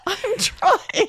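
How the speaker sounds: high-pitched, laughing plea; desperate mock protest. Client answering the angry instructor, mock-desperate high wail.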